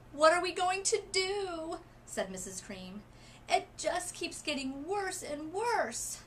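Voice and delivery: high, loud panicked cry that slows toward sobbing; a mother at her wits' end, close to tears; mother near tears, fretting over her daughter; distraught. A narrator's reading. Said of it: "She began to sob".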